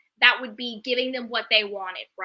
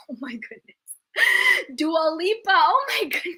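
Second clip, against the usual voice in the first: laughing; 4 semitones higher, 4 dB louder than usual. Real, not acted. Reacting to a cheeky viewer comment.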